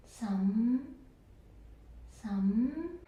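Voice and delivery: some rising gently from low. Shown as a demonstration. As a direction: lift some slightly. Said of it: "this fifth tone".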